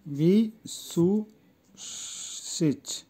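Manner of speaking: ভিসুসিচ very slowly, long gaps between syllables. Shown as a demonstration. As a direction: stretch ভিসুসিচ out piece by piece for learners. New word broken up for learners. (ভিসুসিচ খুব ধীরে, ভেঙে ভেঙে)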